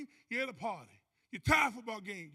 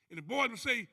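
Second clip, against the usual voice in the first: ordinary talk, nothing marked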